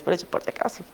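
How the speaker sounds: a hushed half-joking afterthought; whispered aside. Real, not acted.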